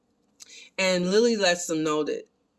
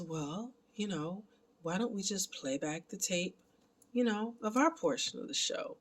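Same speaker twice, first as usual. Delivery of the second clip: very soft, hushed imitation of a character; soft-voiced suggestion; 3 semitones higher, 9 dB quieter than usual. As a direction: suggest it quietly, almost under your breath. Voicing Lily's eerie, casual suggestion.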